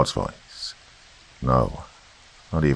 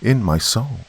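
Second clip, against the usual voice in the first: plain reading voice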